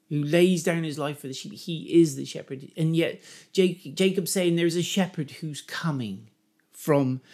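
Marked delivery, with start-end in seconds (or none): none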